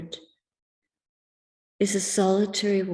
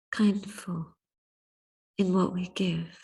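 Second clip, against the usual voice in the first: whisper; 2 semitones lower than usual. Acted. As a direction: whisper the closing words, barely voiced. Reflective talk drops to whisper.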